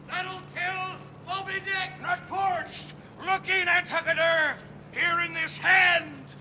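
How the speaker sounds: high, strained distant shouting; calling across the sea. Acted. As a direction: hail the other captain. Shouting between ships at sea.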